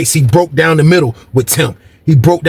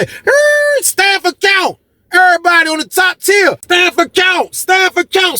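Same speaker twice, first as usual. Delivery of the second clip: very high, drawn-out announcement; imitated count call; slower than usual. Guard calling the top tier to count, high-pitched holler.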